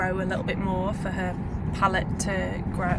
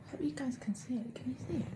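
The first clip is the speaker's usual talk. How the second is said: hushed; 5 semitones higher, 12 dB quieter, faster than usual. Real, not acted. Fireworks on ceiling, hushed.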